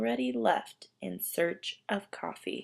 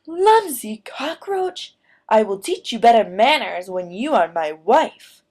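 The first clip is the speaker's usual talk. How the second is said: furious rooster; 11 dB louder than usual. Acted. Furious rooster scolding clumsy Martina, loud and angry. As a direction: bellow with rooster rage.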